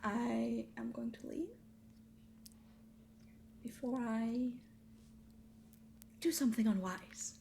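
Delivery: hushed, measured threat with long pauses; quietly restrained, breaking the phrase with pauses; restrained warning. Acted. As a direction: hold the anger in, softly and haltingly.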